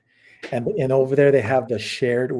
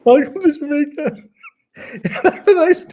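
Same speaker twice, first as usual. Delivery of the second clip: squeaky high voice, much louder; falsetto punchline; 7 dB louder than usual. Retold or imitated. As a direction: sing it out in a silly high voice. Repeats punchline in put-on voice.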